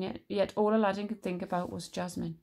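ordinary delivery

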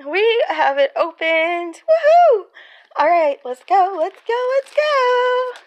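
high, loud whoop of triumph; excited cheering. Real, not acted. Vlogger finally gets the box open, excited cheering.

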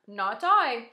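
cat's line. A narrator's reading. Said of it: "said the cat".

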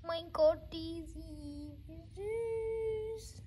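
cooing over photos. Real, not acted. Vlogger finds old family photos, high doting coo.

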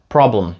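"Problem" with final sounds blended; no vowel in the end of "Problem"; merged PROBLEM. Shown as a demonstration. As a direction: say "Problem" as "prob-lm". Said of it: "останні звуки просто зливаються".

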